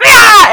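short high yell in imitation; mock battle scream. Host acting out the witch attacking the demon, mock battle scream. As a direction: mimic a loud attacking shriek.

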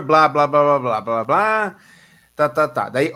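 normal talking voice

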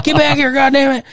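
Southern grandpa shouting for his dog; Southern granddad yelling. Retold or imitated. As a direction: holler across the yard at the dog. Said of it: "being like".

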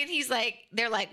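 ordinary talk, nothing marked

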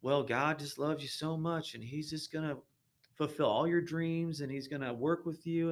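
a light mocking Southern TV preacher lilt; little Southern voice. Mocking TV preachers, a hint of Southern accent. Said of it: "I'm using kinda my little Southern voice".

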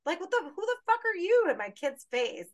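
high, loud mock shout; angry yell. Yelling at a stranger confronting her kids, angry shout. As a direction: tell them off at full volume. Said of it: "I would have fucking been like".

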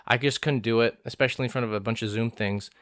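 ordinary delivery

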